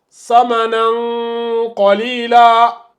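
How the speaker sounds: "samanan qalila" slowly, final n held as a long nasal hum; hidden tanwin. Shown as a demonstration. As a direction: chant "samanan qalila" drawn out, n melting into the q. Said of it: "ça donne".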